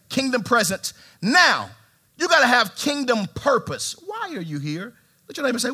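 ordinary talk, nothing marked

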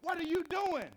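high, loud alarmed cry; a sharp shout at a stranger. Retold or imitated. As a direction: call out to stop him. Wife calls out at attacker.

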